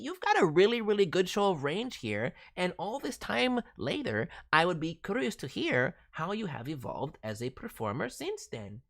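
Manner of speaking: usual speaking voice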